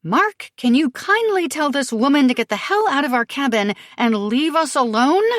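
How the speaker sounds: high tense words through clenched teeth; through gritted teeth. Acted. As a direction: grit your teeth and push it out. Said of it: "Sophie says through gritted teeth".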